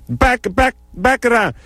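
high, choppy Jamaican commands; Jamaican accent. Retold or imitated. Jamaican searcher directing the traveler, imitated accent. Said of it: "from somewhere in, deep in Jamaica".

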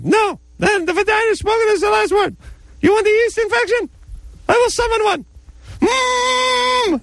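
very high squeaky threatening puppet; ferret voice. Pretend. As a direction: squeak out a tiny menacing threat. Vagina puppet threatens woman.

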